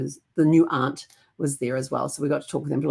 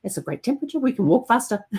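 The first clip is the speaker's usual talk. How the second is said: higher, playful voice for an excited pet; a hint of a dog thrilled by the walk; lightly voiced eager dog; 5 semitones higher, faster than usual. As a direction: playfully voice the dog's delight. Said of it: "she's like".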